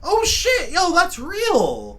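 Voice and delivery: loud, high, shocked outburst; shocked shout. Real, not acted. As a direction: blurt out stunned disbelief. Finds walking plants true.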